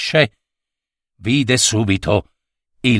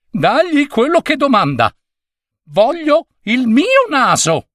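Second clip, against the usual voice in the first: desperate master; 12 semitones higher than usual. Acted. Master desperate for his nose.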